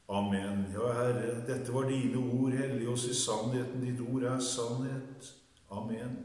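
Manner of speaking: subdued prayer after the reading. Real, not acted. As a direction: drop into a soft, short prayer.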